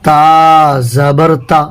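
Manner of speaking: تا زبر تا spelled out very slowly; slow spelling demo. Shown as a demonstration. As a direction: model the syllable slowly for the pupil. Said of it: "جیسے".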